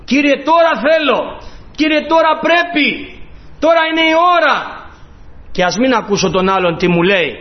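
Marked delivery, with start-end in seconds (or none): 0.0-4.6 building cry. Shown as a demonstration. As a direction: plead harder every time, peaking on τώρα είναι η ώρα. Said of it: "πιο δυνατά μπορώ να φωνάξω".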